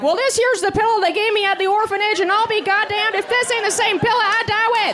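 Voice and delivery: put-on high, twangy old-timer voice, way up in pitch; old prospector. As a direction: play a crusty old gold prospector reminiscing. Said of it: "Like we're some old prospector".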